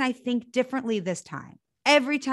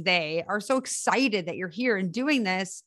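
ordinary talk

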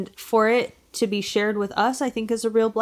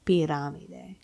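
pirámide with Spanish r and stress on rá; Spanish accent; 5 semitones lower, 6 dB quieter than usual. Shown as a demonstration. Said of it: "my Spanish accent".

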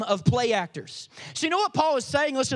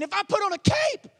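superhero boast; 6 semitones higher than usual. Retold or imitated. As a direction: announce it like a caped hero taking off. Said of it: "it would be like me coming here and saying".

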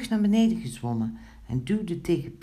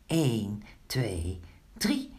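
suspenseful count; 5 semitones lower, much slower than usual. A narrator's reading. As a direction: count deliberately, then pitch the final number up. Counting before everyone pulls hard. (spannend aftellend, laatste tel hoog)

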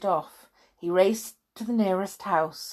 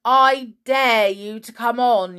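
loud, higher playground jeer; raised-voice bullying dare; yelled taunt; 4 semitones higher, 6 dB louder, slower than usual. A narrator's reading. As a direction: goad them with drawn-out insults. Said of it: "yelled the boy".

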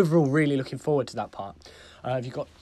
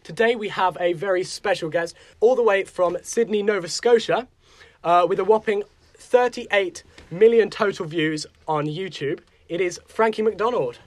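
hyped announcer; 5 semitones higher than usual. Acted. Announcer introduces the guest.

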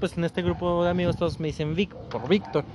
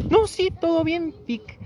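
passive-aggressive mock ex; 12 semitones higher, slower than usual. Ex's passive-aggressive reply.

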